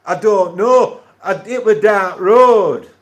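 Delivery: hammed-up Sheffield, very loud and high; exaggerated, slow Sheffield caricature; Sheffield accent. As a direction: bellow it in broad Sheffield with drawn-out vowels. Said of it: "Sheffield accent's terrible".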